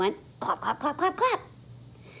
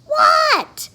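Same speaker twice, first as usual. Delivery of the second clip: squeaky high startled what; 11 dB louder than usual. Acted. Froggy startled by his friend calling. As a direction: jolt up in surprise.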